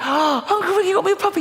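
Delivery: put-on high-pitched squeal of a delighted child; excited little niece. Niece thrilled by uncle's puppy, high excited child voice. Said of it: "I call my niece, she comes running".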